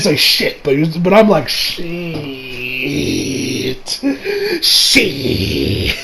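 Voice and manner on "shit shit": drawn-out curse. Retold or imitated. His own long-drawn reaction to the scene.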